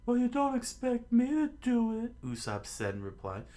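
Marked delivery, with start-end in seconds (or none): 0.1-2.2 loud, very high falsetto protest; high, squeaky indignation; cowardly refusal. Acted. Usopp refuses in the quarrel.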